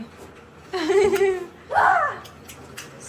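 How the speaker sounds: loud amused laugh; real laugh. Real, not acted. Laughing loudly at the cousin's crooked glasses.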